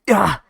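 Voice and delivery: loud high shout of pain; short sharp yell; pained cry. Acted. Bandit falls.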